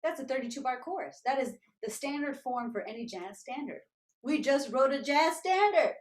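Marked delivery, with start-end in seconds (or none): 4.2-5.9 loud, slow, thrilled realisation; higher, drawn-out excited exclamation; happy excitement. Retold or imitated. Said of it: "We were so happy".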